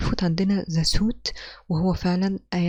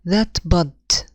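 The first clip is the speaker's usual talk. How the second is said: "That" light, "bud" hit hard and loud; scanning stress; 6 dB louder than usual. Shown as a demonstration. Teacher scans the foot for the class, "bud" punched loud. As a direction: tap out "That bud" as unstressed then stressed. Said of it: "Unstressed, stressed".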